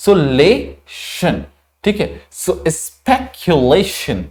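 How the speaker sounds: speculation stretched very slowly, spek-yu-lay-shun; slow model pronunciation. Shown as a demonstration. Teacher slowly modelling speculation from the IPA. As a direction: draw speculation out for learners. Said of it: "क्या प्रोनाउंसिएट करेंगे?".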